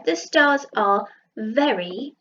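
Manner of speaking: drawn out, word by word; slow pointing read; slow reread. Shown as a demonstration. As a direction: read it back slowly to check. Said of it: "There we go".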